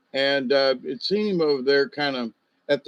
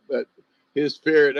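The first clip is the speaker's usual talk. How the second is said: ordinary delivery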